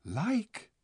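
surprised cry. A narrator's reading. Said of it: "cried Edmund".

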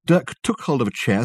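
ordinary delivery, nothing marked

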